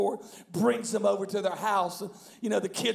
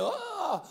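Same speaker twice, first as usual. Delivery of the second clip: high-pitched thrilled cry, imitated; mock excited squeal; 11 semitones higher than usual. Imitating a family meeting the host, excited squeal. Family reacting to host's arrival.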